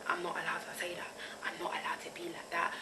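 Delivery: hushed whisper of a suppressed thought. Retold or imitated. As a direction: breathe it out as if forbidden to say. Voicing a suppressed inner thought.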